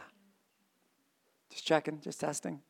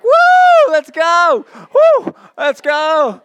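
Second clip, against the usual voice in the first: much higher, loud, slow party whoop; mock hype shout; 16 dB louder, much slower than usual. Preacher imitating churchgoers jumping up, mock whoop. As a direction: whoop like a fan at a game. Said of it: "Some of you get up and like".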